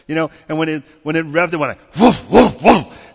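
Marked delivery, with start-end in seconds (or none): 2.0-3.1 imitated engine revving like a barking dog, louder on the last woofs. Telling the congregation about the Porsche engine, mock barking revs. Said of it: "sounded like a dog ready to fight".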